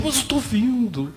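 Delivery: imitated high-pitched, urgent pleading; overwhelmed insistence. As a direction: insist high-voiced, as the amazed convert. Acting man insisting he now sees.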